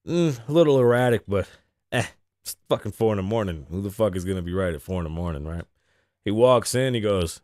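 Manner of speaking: usual speaking voice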